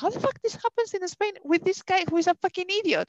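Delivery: high, indignant rant about the thief; retold angry outburst. Telling the policeman about the thief, high and outraged. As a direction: spit it out, pitched high and outraged. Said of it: "I was so angry".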